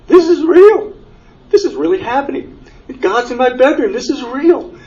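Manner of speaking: high, astonished cry of wonder; retelling the moment the light filled his bedroom, awestruck and high; awestruck exclamation. Retold or imitated. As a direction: voice soaring high as it sinks in that it's real. Realizing God's in the bedroom.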